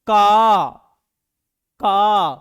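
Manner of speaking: का voiced loud from the throat, k hard; sound demo. Shown as a demonstration. Teacher demonstrating the throat consonant का to the class, loud. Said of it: "कहां से आवाज निकल रहा?".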